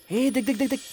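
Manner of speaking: high, urgent repeated words; excited, high-pitched rattle of words; excited pointing out. Acted. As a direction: get your friends to look, pitched high. Spots the girl's dress.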